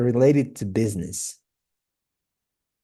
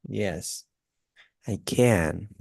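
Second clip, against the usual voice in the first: ordinary talk, nothing marked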